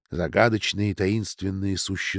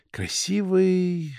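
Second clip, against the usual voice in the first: high, very slow, long-held vowel; drawn-out lovestruck sigh; dreamy drawl; 9 semitones higher, much slower than usual. A narrator's reading. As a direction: stretch it out in swooning admiration. Dreamy praise of an admired boy.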